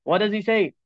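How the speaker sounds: normal talking voice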